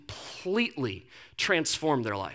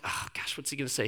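whispered worry; 3 semitones lower, 10 dB quieter than usual. Retold or imitated. Inner worried thought, whispered.

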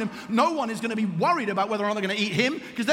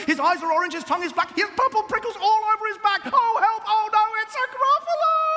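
high, loud storybook panic building to a wail; alarmed mouse; 10 semitones higher, 3 dB louder than usual. Acted. Mouse meeting the Gruffalo, acted high-pitched alarm. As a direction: start on a high cry, build to a mock-terrified shout.

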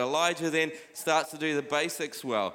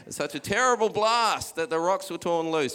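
hammed-up scripture reading, louder; playful dramatic read; 2 semitones higher, 4 dB louder than usual. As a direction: ham up the blast for effect. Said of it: "this is a very dramatic translation".

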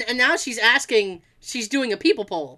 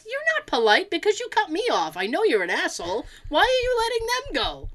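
indignant, pitch leaping high; retold tirade; 6 semitones higher than usual. Telling off people who cut you off, indignant tirade. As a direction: scold them in a high, exasperated voice. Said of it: "I went on that same tirade".

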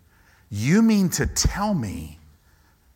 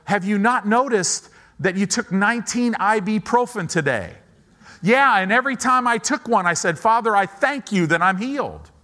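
higher, louder back-and-forth with the doubting mind; heated inner argument; 8 semitones higher, 5 dB louder than usual. Pretend. Pastor acting out an argument with his doubting mind, higher and louder. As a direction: argue back loudly against your own doubts. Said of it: "your mind's gonna go".

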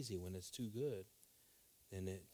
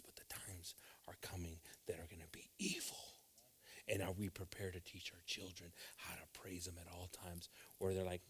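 ordinary delivery

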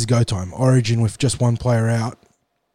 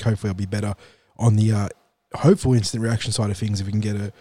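ordinary delivery

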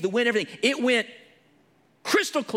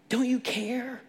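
higher, a touch of panic; frightened disciples; 8 semitones higher, slower than usual. Retold or imitated. Disciples waking Jesus in the storm, mock panic. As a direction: plead it like a scared sailor. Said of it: "they're like".

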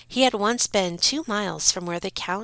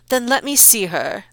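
louder, higher demand; commanding Count; 5 semitones higher, 7 dB louder than usual. Acted. Count demands to see the daughter. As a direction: insist on seeing her.

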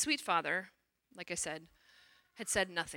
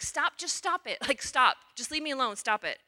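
high, loud, fed-up pleading to stop; retelling a frustrated teen snapping at her mom; exasperated pushback; 6 semitones higher, 3 dB louder than usual. Retold or imitated. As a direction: push her away, sharp and annoyed. Daughter fights mother on phone.

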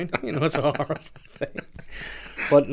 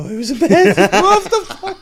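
loud, high, cracking up mid-story; real laughing; 11 dB louder than usual. Real, not acted. Man retells not being recognised in bed, cracking up. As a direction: let laughter push the voice right up.

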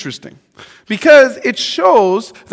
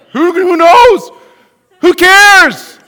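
higher and louder who cares; carefree dismissal; 9 semitones higher, 9 dB louder, much slower than usual. Retold or imitated. Carefree person before kids brushing it off, loud. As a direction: toss it off loudly. Mock carefree pre-baby self.

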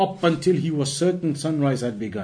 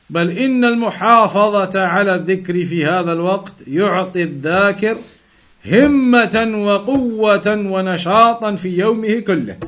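quick, steady recitation of the Arabic passage; fast formal text reading; 4 semitones higher, 4 dB louder, faster than usual. Acted. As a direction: read the classical text aloud briskly, like a scholar citing a source. Reads classical Arabic source text.

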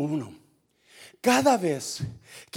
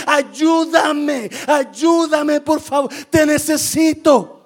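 high, raised pleading cries; crying for help; 8 semitones higher, 8 dB louder, much slower than usual. Acted. People in need calling out to you, crying for help. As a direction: plead at preaching volume, higher than usual. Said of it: "que está gritándole a gritos".

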